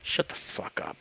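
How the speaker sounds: low-volume whispered threat; hushed, threatening order; menacing whisper. Acted. Leo threatens Duke after slapping him.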